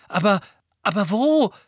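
high, loud, stumbling question; start, break off, then ask in disbelief; bewildered shock. A narrator's reading. Friend stunned, questions the news.

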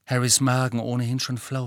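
plain reading voice

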